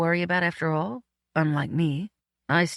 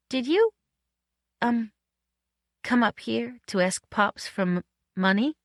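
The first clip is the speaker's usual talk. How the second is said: higher, halting, awkward question; hesitant asking; 6 semitones higher than usual. A narrator's reading. Woman asks man about his motive, haltingly. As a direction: feel your way into a delicate question, pausing.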